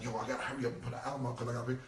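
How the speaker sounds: quick, laughing mock panic; mock hurry. Mocking rappers rushing albums to pay bills, quick and laughing. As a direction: rattle it off like a broke rapper. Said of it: "It's not like".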